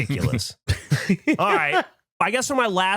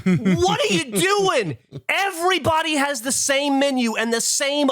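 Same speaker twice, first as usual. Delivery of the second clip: exasperated rant; 7 semitones higher than usual. Real, not acted. Ranting at indecisive diner.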